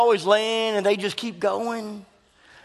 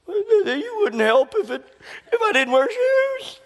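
put-on whiny child; 13 semitones higher than usual. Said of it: "She said".

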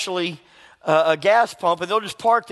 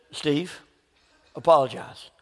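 lower, soft, firm command; quiet and final, with a pause; the Lord's voice; 3 semitones lower, 3 dB quieter than usual. Retold or imitated. The Lord gently insisting to an angry man, quiet and firm.

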